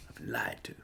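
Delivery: hushed, whispered grievance; mock betrayed whisper. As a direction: whisper it like a wronged party. Mock grievance at being misled.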